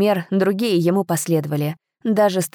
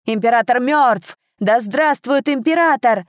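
exclaiming; 6 semitones higher than usual. A narrator's reading. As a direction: exclaim the news triumphantly. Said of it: "снова воскликнул кто-то".

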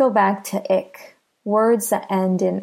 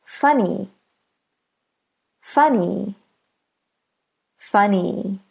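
"funny" with FUN stressed; stress on first syllable. Shown as a demonstration. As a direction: say "funny" with the beat on FUN, ny lighter. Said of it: "Listen to the first syllable".

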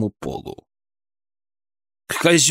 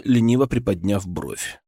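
usual speaking voice

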